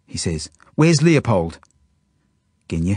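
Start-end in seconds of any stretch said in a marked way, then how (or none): 0.8-1.6 loud, high, angry question; angry demand. A narrator's reading. Dove confronts Genya about Leopold, angry. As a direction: demand an answer, voice raised. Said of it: "he turns to her angrily".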